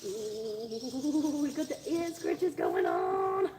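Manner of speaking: high, drawn-out cooing; delighted pet talk. Real, not acted. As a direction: make a slow, swooping fuss of an animal. Fussing over the pig.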